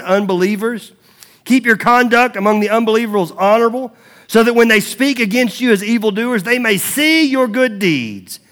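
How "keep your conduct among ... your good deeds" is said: raised, higher preaching voice; emphatic preacher reading aloud; scripture reading. Acted. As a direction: proclaim the verse forcefully to the congregation. Reads scripture to congregation.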